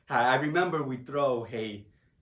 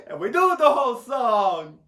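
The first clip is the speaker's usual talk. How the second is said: tearful laughing; 6 semitones higher, 5 dB louder than usual. Acted. Laughing, tearful memory of son.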